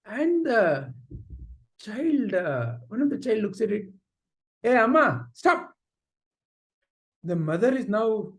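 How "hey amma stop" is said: raised, sharp command to stop; mild mock yell. Child tells crying mother to stop, acted yelling. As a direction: act a child cutting short the crying. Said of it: "Why is the child yelling at me?".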